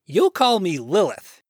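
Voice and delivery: loud, emphatic self-naming; defiant declaration. Acted. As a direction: lay down the law to Adam. Woman defiantly names herself.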